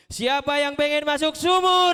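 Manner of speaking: playful shout. Acted. Trick question shouted to kids.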